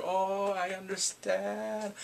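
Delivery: slow, drawn-out mock sob; mock crying. Crying man being interrogated, imitated crying. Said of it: "he's crying while they're interrogating him".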